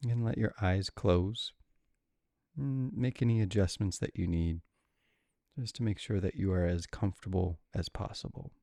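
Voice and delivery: calm, unhurried guide with long pauses; guided meditation. Real, not acted. Meditation guide settling listeners with adrenal insufficiency, calm and unhurried. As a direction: ease them gently into stillness, leaving room between phrases.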